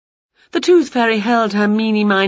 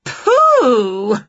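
loud high disgusted shout; 6 semitones higher, 5 dB louder than usual. A narrator's reading. Winnie smelling the fairy, loud disgusted shout. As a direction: yell it out in disgust. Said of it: "she shouted".

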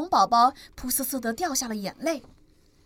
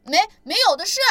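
stammering retort; 8 semitones higher, 5 dB louder, much faster than usual. Acted. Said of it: "反驳道".

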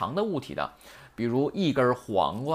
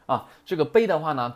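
ordinary delivery

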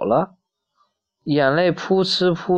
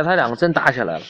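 plain reading voice

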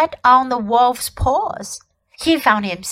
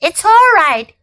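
nervous self-reassurance; 5 semitones higher, 5 dB louder than usual. A narrator's reading. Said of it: "he kept saying to himself".

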